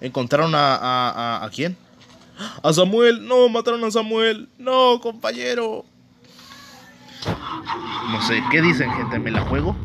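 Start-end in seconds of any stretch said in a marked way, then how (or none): 2.6-5.9 dismayed cry. Real, not acted. Teammate just killed.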